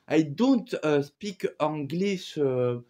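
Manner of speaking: put-on halting English in a thick French accent, slow and loud; clumsy French-accented English. Speaking to airport staff abroad, in slow French-accented English. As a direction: stumble through the English word by word, French accent. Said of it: "mon accent de anglais, il était pas très bien".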